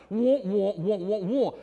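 muffled cartoon wah wah babble; Peter babbling wah-wah. Preacher mimicking flustered Peter, muffled wah wah babble. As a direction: babble like a mouth full of foot. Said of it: "that's Peter with his foot stuck in his mouth".

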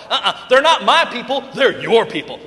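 loud laughing mock protest; hot potato banter. Moses laughingly pushes the people back to God in mock protest. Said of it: "played hot potato back and forth".

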